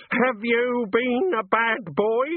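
high, slow, drawn-out reproach; piercing mother's voice, each word stretched; scolding mother. Acted. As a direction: scold your grown son like a child. Mother scolds Walter with beady gaze.

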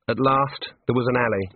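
ordinary delivery, nothing marked

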